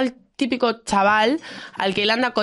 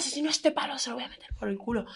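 man's threat; 11 dB quieter than usual. Retold or imitated. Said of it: "coge el pavo y dice".

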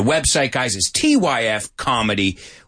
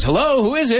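higher-pitched retold intercom greeting; answering the buzzer; 10 semitones higher than usual. Seinfeld answering his buzzer, high questioning retold greeting. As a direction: imitate someone calling into a buzzer. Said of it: "somebody rings the buzzer".

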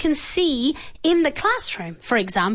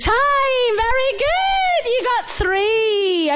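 excited praise; 7 semitones higher, slower than usual. Acted. Quiz host praising team.

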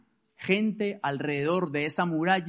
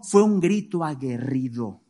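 usual speaking voice

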